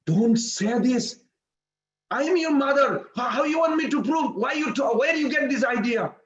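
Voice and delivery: high, insistent pleading; distressed mother protesting. Retold or imitated. Mother protests son's denial.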